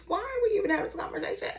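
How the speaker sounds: high-pitched, rapid mock exasperation; fast, squeaky-high incredulous questioning; exasperated disbelief. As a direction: rattle it off high, as if baffled. Acts out listener's exasperated reaction.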